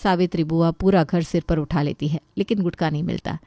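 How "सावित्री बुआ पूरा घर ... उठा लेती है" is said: ordinary delivery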